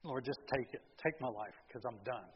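soft, low, worn-out plea; preacher voicing his own tired prayer, soft and low; weary prayer. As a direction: pray it like you have nothing left. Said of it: "I just say".

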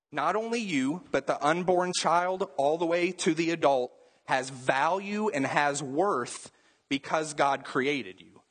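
usual speaking voice

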